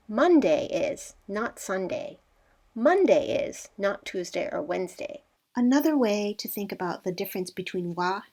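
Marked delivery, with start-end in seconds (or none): 0.1-1.1 slow, Monday lifted and held; Monday said slowly, set apart; stress on Monday. Shown as a demonstration. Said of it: "you're really emphasizing the word". 2.7-3.7 Monday pitched up and pushed; stress on Monday. Shown as a demonstration. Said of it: "you're really emphasizing the word".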